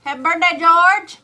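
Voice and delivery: loud, high birthday cheer; called-out birthday greeting. Real, not acted. Woman wishing a stream viewer happy birthday, calling out. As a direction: call it out high and loud, rising at the end.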